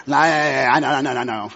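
mock kid's low, run-together protest; a hint of know-it-all kid; lightly voiced dismissive child. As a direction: imitate a child tuning out a parent. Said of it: "They're like".

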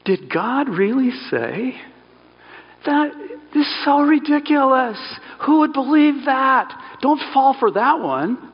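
high, sneering scoff at God's words; mocking voice. Shown as a demonstration. Serpent mocking God's command to the woman, sneering high and slow. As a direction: ridicule it like a tempter sneering at a silly rule. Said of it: "it's the mocking voice".

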